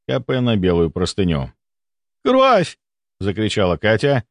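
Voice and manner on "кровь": high-pitched cry of alarm; panicked cry. A narrator's reading. Katya spots blood from the patient's nose, panicked cry. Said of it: "в её голосе была настоящая паника".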